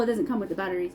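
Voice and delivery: ordinary delivery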